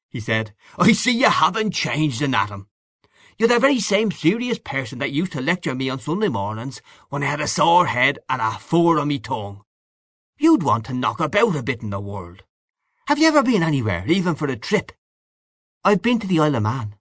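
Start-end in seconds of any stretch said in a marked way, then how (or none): 0.8-14.9 high, swinging Irish bar-room voice; boisterous Irish man-about-town; hearty Irish old pal. A narrator's reading. Same figure. 15.8-17.0 quick, higher-pitched meek reply; small, hurried answer; timid admission. A narrator's reading. Timid friend admits his one trip.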